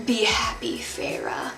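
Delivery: soft breathy whisper of a farewell. Retold or imitated. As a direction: whisper it like a sad goodbye. Tamlin's parting words.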